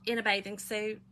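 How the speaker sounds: ordinary talk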